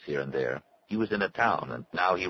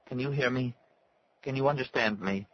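anxious plea; 8 semitones higher than usual. A narrator's reading. Pleading to unresponsive boy.

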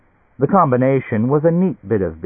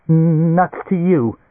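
stretched, higher stammer on the first sound; 8 semitones higher, 4 dB louder, slower than usual. A narrator's reading. Nat defies Bolero, stammering. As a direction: stick on the opening consonant, then get it out. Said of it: "Nat stammered".

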